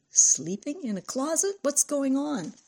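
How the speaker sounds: high, questioning disbelief; girl baffled by the news; incredulous. A narrator's reading. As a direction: pitch soaring in astonishment. Friend reacts to closet news.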